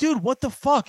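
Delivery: high-pitched mock outrage; retold whine of disbelief at dad; indignant protest. Retells protesting to his dad.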